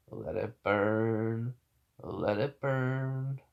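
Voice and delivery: slow, stretched-out delivery; drawn-out joke. Acted. Storyteller joking about the burning jacket, slow and drawn-out. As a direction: linger on each word, milking the gag.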